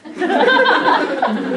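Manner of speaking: loud burst of laughter; real laughter; laughing. Real, not acted. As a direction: crack up loudly. Cast laughing.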